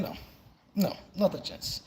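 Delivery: usual speaking voice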